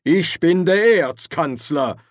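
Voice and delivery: loud, high, slow indignant claim; Ridcully asserting rank over the dean; pulling rank. Acted.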